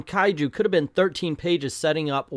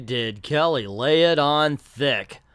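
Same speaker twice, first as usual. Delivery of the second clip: ordinary talk, nothing marked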